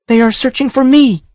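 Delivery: high excited female voice; exclaimed. A narrator's reading. Princess realises the host seeks her, high and eager. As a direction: burst out eagerly. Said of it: "she exclaimed".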